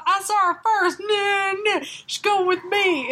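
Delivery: put-on Southern cowboy accent. Cowboy claims the woman from rival, Southern accent. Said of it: "cowboy fuck is just like".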